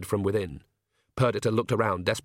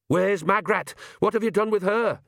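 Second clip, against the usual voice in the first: demanding; 9 semitones higher than usual. Acted. Agnes confronting vampires.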